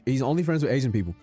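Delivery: ordinary delivery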